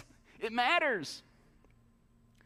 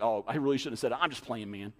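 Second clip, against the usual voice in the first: mock excuse; 11 semitones lower, faster than usual.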